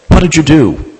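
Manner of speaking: high, loud alarmed retort; startled question. Retold or imitated. Nurse reacting to a patient's sudden death, alarmed. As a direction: ask it sharply, caught off guard.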